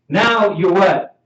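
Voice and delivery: ordinary talk, nothing marked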